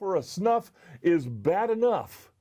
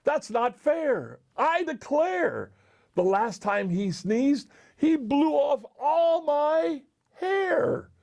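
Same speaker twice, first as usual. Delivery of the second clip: slow, high-pitched complaint; lightly voiced bear, protesting with drawn-out words; crying-out bear; 8 semitones higher than usual. A narrator's reading. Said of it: "cried the bear".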